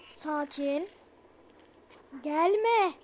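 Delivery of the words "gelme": pleading rising to high, loud frantic yells; panicked screaming. Real, not acted. Gamer begging the monster not to come, then screaming to run. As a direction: build from begging to a terrified shriek.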